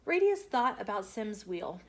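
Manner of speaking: ordinary delivery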